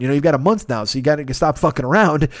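ordinary delivery

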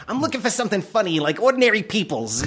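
high-pitched, emphatic explaining; over-enunciating to deaf man. Retold or imitated.

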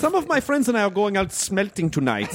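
put-on a high, suggestive German accent as an invitation; fetish German. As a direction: tempt them in a sly German voice. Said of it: "It's fetish German".

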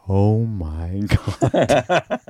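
very drawn-out, awed murmur; stunned crowd reaction. Retold or imitated. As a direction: slowly stretch it out like a stunned room. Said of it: "the whole room was going".

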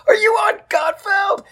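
very high piercing shout. Retold or imitated. Customer service lady shouting the question across the airport, very high and loud. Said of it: "Fucking really loud".

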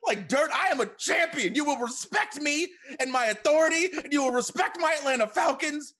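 high shouted wrestler promo; mock outraged champion. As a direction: yell like a wronged wrestling champ. Mock wrestling-champion rant at hosts.

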